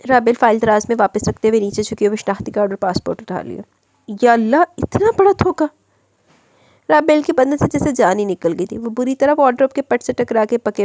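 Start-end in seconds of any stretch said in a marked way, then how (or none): 4.2-5.7 a high, stunned cry of betrayal; wife discovers husband lied, shocked. A narrator's reading. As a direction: gasp it out in disbelief.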